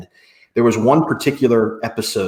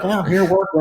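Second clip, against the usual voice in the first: grudging reply; 8 semitones higher than usual. Retold or imitated. His reply to partying friends.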